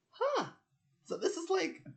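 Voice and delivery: very high, loud wondering squeak; mock amazement. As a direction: marvel at it in a high put-on voice. Acting out seeing a lemon.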